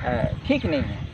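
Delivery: ordinary talk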